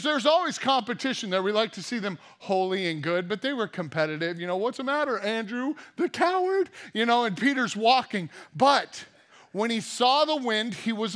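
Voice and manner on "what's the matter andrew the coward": high, jeering taunt; mocking taunt. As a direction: needle him like a schoolyard bully. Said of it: "teasing the other apostles".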